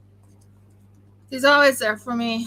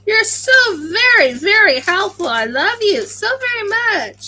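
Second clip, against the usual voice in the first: fake gratitude; 8 semitones higher, 8 dB louder than usual. Real, not acted. Teasing husband's unhelpful advice.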